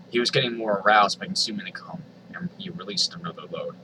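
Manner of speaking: ordinary talk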